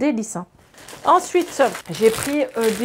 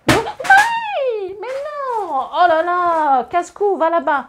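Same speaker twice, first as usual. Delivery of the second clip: much higher, loud startled scolding; sudden shout; 8 semitones higher, 6 dB louder, much slower than usual. Real, not acted. Scolding kid or pet off-camera.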